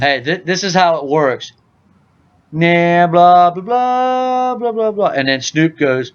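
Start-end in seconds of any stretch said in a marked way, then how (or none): 2.5-5.1 high, sing-out run of blah-blahs; hammed-up blah-blah chatter; mock chatter. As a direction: imitate someone yammering on, pitched up. Mimics chatter before Snoop's snore.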